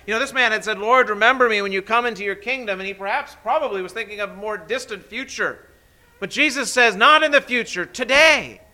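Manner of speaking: preaching at full force. Acted. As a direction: proclaim each word of the promise, building. Preaching climax continues.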